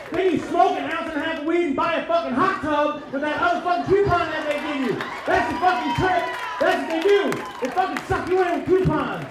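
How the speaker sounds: high, heated exposé of a scam; worked-up comic yelling; shouted rant. Acted. Rant about the coupon trick.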